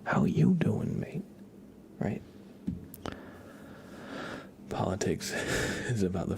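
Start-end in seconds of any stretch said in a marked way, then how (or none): 0.0-1.2 "you" pitched high in "how you doing, mate"; greeting peaking high on "you"; stress on YOU. Shown as a demonstration. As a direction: lift the word "you" high, then drop for "mate". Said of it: "that's how you wanted me to say it".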